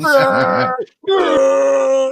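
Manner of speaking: long, loud, high mock battle scream; drawn-out parody action-hero roar. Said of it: "[name] did the Rambo skit".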